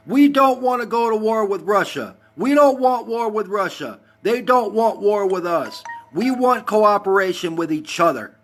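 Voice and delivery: high-pitched, insistent protest; worked-up rant. Real, not acted. Heated political rant to live viewers.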